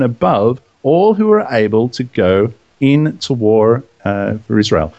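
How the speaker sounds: measured, emphatic Bible reading; scripture reading. Acted. Host reading Numbers to his co-hosts, emphatic scripture reading. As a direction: read out phrase by phrase with weight.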